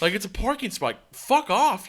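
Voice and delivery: high, loud, dismissive comeback; annoyed retort. Retold or imitated. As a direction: re-enact telling the neighbor off. Retelling his retort in the quarrel.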